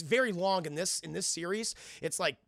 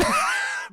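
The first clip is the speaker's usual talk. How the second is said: high laugh; 7 dB louder than usual. Real, not acted. Laughing at the scene.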